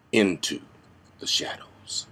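sinking into a near-whisper; dramatic hush. Acted. Actor announcing his step into vigilantism, hushed. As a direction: let the words fade away low and soft.

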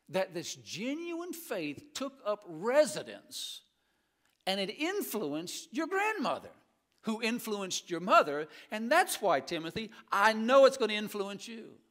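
high, loud, slow preaching climb; preaching at full force. Acted. Preacher voicing Paul's words to Timothy, preaching at full force. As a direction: build Paul's words to a climax.

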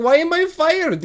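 a fired worker asking why in disbelief; mock disbelief. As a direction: squeak it out in outrage. Retelling being fired.

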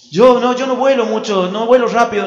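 high, hurried backing-out; panicky excuse. Acted. Angel refusing to be sent down to earth, panicky. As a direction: wriggle out of it, mock alarm.

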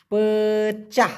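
পেচাহ drawn out slowly; slow careful pronunciation. Shown as a demonstration. Teacher carefully modelling পেচাহ for students, slowly. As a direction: stretch পেচাহ for learners. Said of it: "সেক্ষেত্রে হবে পেচাহ".

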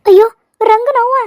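loud, high startled gasp of recognition; alarmed shock. Acted. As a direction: blurt it out in dismay. Shock at unexpected visitor.

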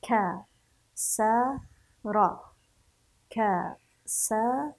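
كسر split into ka, sa, ra; كسر in three separate, even beats; syllable by syllable. Shown as a demonstration. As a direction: sound out كسر very slowly, pausing after each syllable. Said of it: "الآن نقرأ هذه الكلمة".

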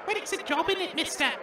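high, soft urchin voice; a meek little orphan pipes up. Acted. The orphan answers the reporter, small and high.

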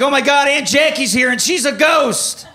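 high, loud alarm; hammed-up frightened outburst; panicked kid. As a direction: burst in reporting a ghost, panicked. Child alarmed at ghost, to mom.

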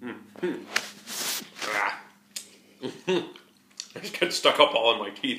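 chewy muffled words; talking while chewing. Real, not acted. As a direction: mouth partly full of candy. Seen 0: Lips pursed, jaw working between phrases; later mentions candy stuck in teeth.

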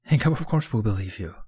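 lightly exasperated reassurance; a touch of exasperation. A narrator's reading. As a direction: interrupt to reassure, half amused. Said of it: "an exasperated laugh in his voice".

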